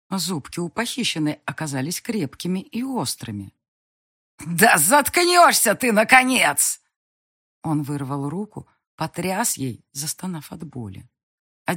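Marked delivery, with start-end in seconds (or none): 4.4-6.8 loud, slow, higher furious shout; yelling. A narrator's reading. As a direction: shout through the pain. Banker yells while bitten.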